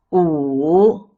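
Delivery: "wu" dipping, then climbing; falling-rising tone. Shown as a demonstration. Teacher leads repeat drill, dipping wu. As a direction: sink then lift a long "wu". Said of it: "The third tone is the falling rising tone".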